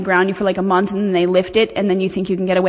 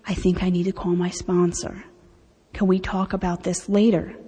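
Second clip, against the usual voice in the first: quiet calm request; 4 semitones lower, 7 dB quieter than usual. Shown as a demonstration. Calmly stepping away when angry.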